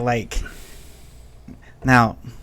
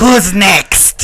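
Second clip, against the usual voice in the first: loud, raised shout; menacing challenge; 4 semitones higher, 10 dB louder than usual. Acted. Fighter intimidating the remaining bandits, menacing shout. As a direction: call out the next victim.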